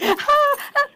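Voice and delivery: high, loud "ah, ah"; high exclamation. Retold or imitated. Herself carrying on at the gym, high and loud. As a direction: burst out with high little cries.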